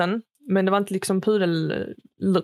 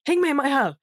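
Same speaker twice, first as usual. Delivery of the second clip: mock angry demand, pitched higher; hammed-up furious older man; imitating a fuming neighbour; really angry; 6 semitones higher than usual.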